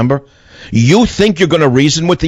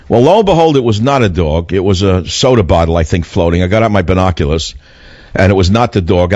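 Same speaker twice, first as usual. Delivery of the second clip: ordinary delivery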